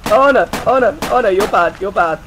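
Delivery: high, loud, repeated cries; alarmed yelling. Real, not acted. Gamer being shot at, loud alarm. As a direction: cry out as if under attack.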